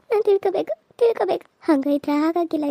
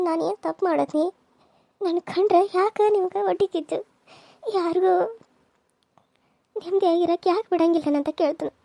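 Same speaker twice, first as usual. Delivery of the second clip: ordinary delivery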